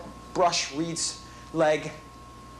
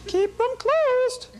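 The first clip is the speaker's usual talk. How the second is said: very high, slow, loud squeak; squeaky high voice; 4 dB louder, much slower than usual. Pretend. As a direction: stretch it out in a tiny squeak. Repeated in changing voices.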